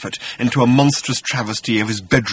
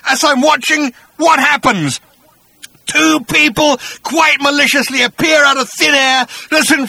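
high, loud, ranting monster voice; a wronged beast building his case; aggrieved bat-creature; 5 dB louder than usual. Acted. Agrajag tells his grievance.

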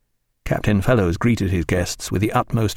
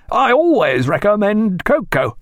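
high, slow surgeon's pronouncement; authoritative call; 11 semitones higher, 4 dB louder than usual. A narrator's reading. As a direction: lay it down with raised-voice authority. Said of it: "in an authoritative voice".

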